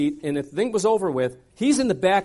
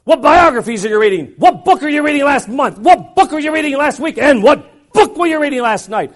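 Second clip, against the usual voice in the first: grilling questions; 7 semitones higher, 7 dB louder than usual. Retold or imitated. Rapid-fire questioning.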